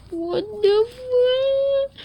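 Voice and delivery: high, drawn-out shocked cry. Real, not acted. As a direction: cry out in disbelief, slowly. Sudden shock in game.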